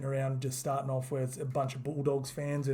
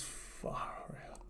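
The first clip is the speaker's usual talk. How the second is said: whisper; 14 dB quieter than usual. Real, not acted. Marvelling at player's run.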